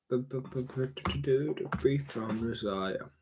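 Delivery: drawn-out free from desire. Shown as a demonstration. Streamer repeating the motto, dragging it out slowly. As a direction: stretch every word of "Free from desire" out long. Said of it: "repeated phrase, drawn out".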